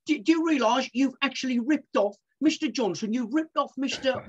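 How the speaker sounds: high-pitched confronting presenter; Dominic Littlewood chasing. Acted. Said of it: "he would literally chase somebody".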